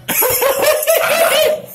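loud high-pitched pained laugh; shrieking laugh. Real, not acted. Laughing in pain after wings.

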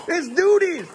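"It's duties" pitched high, in a squeaky mock voice. Dad mimicking his own video for the laughing kid, squeaky. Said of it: "me saying".

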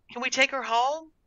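put-on high little-girl request; pleading child. A narrator's reading. Girl holding the cat to her guardian, pleading. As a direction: ask hopefully in a high voice.

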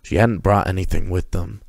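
plain reading voice

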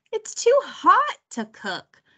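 high, dragged-out protest; whiny complaint. A narrator's reading. Martin refuses the cooking contest in the heat, whining. Boy whines about the heat.

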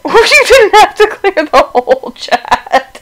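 high, slow, laughing through the words. Real, not acted. Laughing at game enemy clearing chat.

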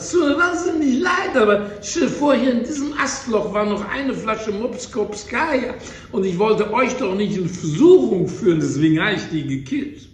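put-on the hare's squeaky drunken excuse. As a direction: play the drunk hare squeaky and loud. Drunk hare, higher second time.

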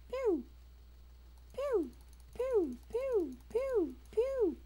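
high falling zap noises; a string of mimicked blaster shots; playful laser pews. Player firing in a game, high mimicked pew noises.

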